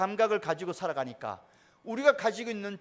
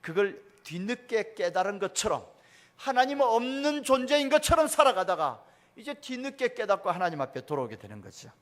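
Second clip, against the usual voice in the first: usual speaking voice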